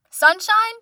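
high, loud questioning call. Retold or imitated. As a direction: imitate someone announcing a name to a crowd. Transport guy calling out dog's name.

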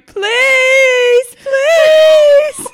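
very high, loud, strained plea; desperate begging. Retold or imitated. To the midwives, begging for pain relief in a loud high wail. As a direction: beg as if in agony. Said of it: "And I'm going".

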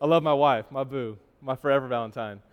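cheesy tender. Real, not acted. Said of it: "Enough of the cheesy stuff".

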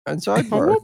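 high, rising Zoidberg bit. Retold or imitated. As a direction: squeak out the name like Zoidberg. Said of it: "repeating the Zoidberg bit".

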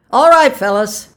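high, loud announcement; calling out. A narrator's reading. Official signals the men to open slips, loud. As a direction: call it out to everyone.

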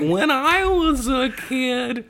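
high, slow whiny comedian voice; slow high-pitched weirdo voice; Emo Phillips impression. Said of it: "the weird comedian, and he's like".

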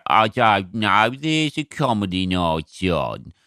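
put-on slow, lightly done Birmingham accent, vague and clueless; a hint of a Brummie receptionist who doesn't care. Imitating a clueless Birmingham venue worker, slow Brummie accent. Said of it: "because of that accent".

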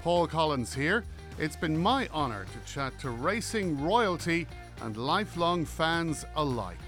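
upbeat announcer read over music; bright radio promo voice; podcast intro read. Acted.

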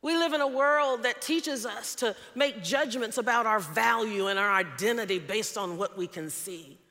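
loud, rising sermon cadence; preaching at full force. Acted. Pastor exhorting the congregation, loud and rising. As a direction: build it like a pulpit appeal.

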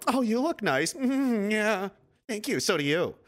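high, falsely sweet exchange between women; mock passive-aggressive. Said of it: "it's all very passive aggressive".

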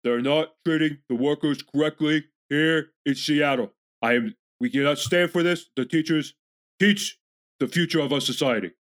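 halting, emphatic politician impression; Bernie Sanders. Host as senator standing up for teachers, emphatic impression. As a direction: deliver it in chunks like a rally speech. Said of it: "I could do an okay Bernie".

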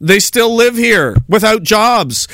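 ordinary talk

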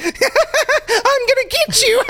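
very high-pitched cackling stoner killer; giddy high-pitched maniac cackle; stoned maniac killer. Pretend. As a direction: squeal the threat through wild stoned laughter. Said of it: "and I'm like".

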